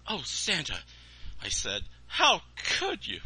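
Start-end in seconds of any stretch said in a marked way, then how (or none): 0.1-0.8 put-on higher, pained child voice; dismayed boy. Boy heartbroken at Santa, higher pained voice. 2.1-3.2 put-on high, slow, dismayed child plea; shocked boy. Boy heartbroken at Santa, high slow plea.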